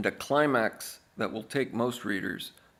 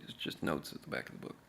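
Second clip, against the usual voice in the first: tossed off under the breath, quicker; hushed aside; 8 semitones lower, 13 dB quieter, faster than usual. Shown as a demonstration. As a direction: drop to a fast whisper. Repeats the line, then hushes it.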